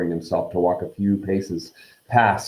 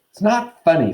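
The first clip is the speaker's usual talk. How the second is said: fierce; 8 semitones higher, 4 dB louder than usual. Acted. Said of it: "said Ron fiercely".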